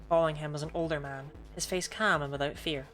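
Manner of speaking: usual speaking voice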